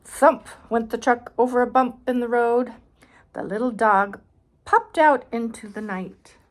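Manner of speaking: put-on reading aloud to a child, clear and bright; storybook reading voice; story narration. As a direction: narrate the picture book. Reads picture book aloud.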